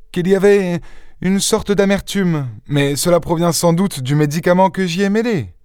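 put-on high-pitched young woman, searching for words; Jeanne, hesitant young woman. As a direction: raise the voice high, hesitant at first. (jeune fille hésitante, voix aiguë)

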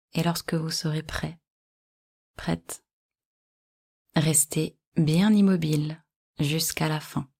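usual speaking voice

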